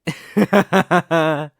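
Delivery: loud, high staccato laugh; ringing villain cackle; gloating villain laugh. Acted. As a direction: laugh at the sudden realisation. Villain realises who planned it.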